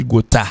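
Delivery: gotha said "go-TA" with a hard t; common mispronunciation. Shown as a demonstration. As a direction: say gotha French-style, crisp t and final a. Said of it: "en pile monde qui pas connaît".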